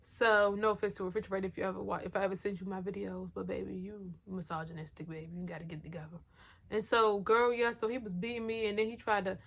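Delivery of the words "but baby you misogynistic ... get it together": put-on much softer, teasing put-down with a sugary baby; mock-sweet scolding. Speaking to Refrigerator about his misogyny, soft mock-sweet scolding. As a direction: scold him gently but pointedly, almost under her breath.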